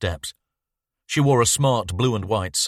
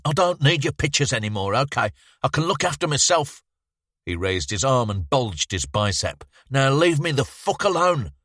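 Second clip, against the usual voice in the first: a touch of angry youth, higher; defiant rejection; 7 semitones higher than usual. Acted. Bowman telling the boy to leave him alone, mild defiance. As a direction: push him away, tough and proud.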